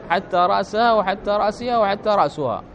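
"رأسها" said as ra'saha, then ra'siha, then ra'suha; change the vowel before ها in each "رأسها": a, i, u; three case endings. Shown as a demonstration. Teacher listing three valid readings, "رأسها" with a, i, u endings. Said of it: "فقد تكون عاطفة فتكون بالنصب".

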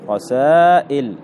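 رسائل slowly, long aa then a glottal stop; alif turned hamza. Shown as a demonstration. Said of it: "همزة". (نطق رسائل ببطء مع تحقيق الهمزة)

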